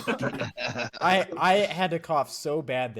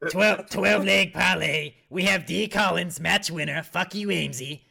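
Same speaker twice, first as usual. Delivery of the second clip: raspy smoker voice; 3 dB louder than usual. Pretend. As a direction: rasp it out like a heavy smoker hyping his parlay. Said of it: "I sound like a smoker".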